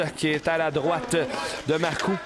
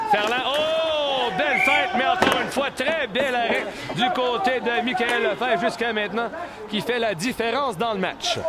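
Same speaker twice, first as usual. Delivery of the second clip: excited sports commentary; 8 semitones higher than usual. Real, not acted. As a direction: cry out at the save. Big save in live commentary.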